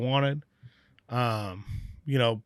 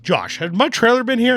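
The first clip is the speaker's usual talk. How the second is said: higher-pitched retold call to a coworker; urgent questioning; 9 semitones higher, 4 dB louder than usual. Brewer asks a coworker about his missing trailer, urgent questioning.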